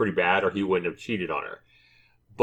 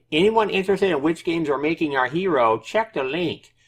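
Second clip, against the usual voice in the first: higher-pitched mock developer voice; developer's voice; 7 semitones higher than usual. Re-reading the developer's sign-off to viewers in a higher mock voice. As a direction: read it out in a raised put-on voice. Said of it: "try to read this in his, in his voice".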